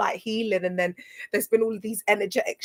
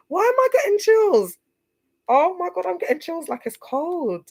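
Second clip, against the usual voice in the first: excited disbelief; 11 semitones higher, 5 dB louder than usual. Real, not acted. Getting chills on stream.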